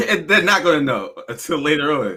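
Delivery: laughing. Real, not acted. Laughing while starting his rant.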